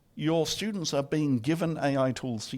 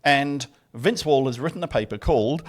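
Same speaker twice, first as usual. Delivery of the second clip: normal talking voice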